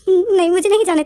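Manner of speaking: shocked refusal. Retold or imitated. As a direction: blurt the no out in a retold yelp. Shocked boy refuses to leave.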